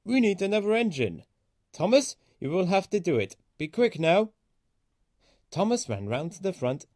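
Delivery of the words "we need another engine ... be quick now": higher, urgent orders; urgent controller. A narrator's reading. Fat controller urges Thomas to hurry, higher and urgent. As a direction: hurry the engine along. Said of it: "He said".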